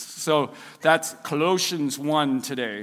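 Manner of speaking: a hint of the deep Bible-app reader; deep narrator voice. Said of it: "I'm gonna try to do it better".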